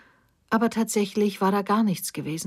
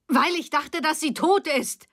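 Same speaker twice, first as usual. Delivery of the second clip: high-pitched, anguished outcry; acted yell; 9 semitones higher than usual. A narrator's reading. Daughter defends herself to her mother, crying out. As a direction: cry it out in protest, pitch jumping up. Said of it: "schrie Silvia jetzt".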